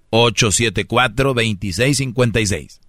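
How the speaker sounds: usual speaking voice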